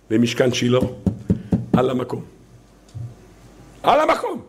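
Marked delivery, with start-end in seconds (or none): 3.8-4.4 high-pitched shouted repeat; sudden emphatic outburst. Shown as a demonstration. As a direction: cry it out, pitched way up. Same phrase repeated as outburst.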